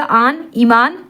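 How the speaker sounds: आन drawn out on its own, then joined into ईमान; added aan ending. Shown as a demonstration. Teacher showing students how आन is added: आन said alone, then ईमान. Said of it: "आन कर दिया जाएगा".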